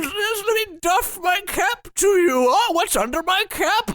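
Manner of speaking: high hammy villain voice; mustache twirling villain. Acted. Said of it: "twirling the cap as well as he's doffing it".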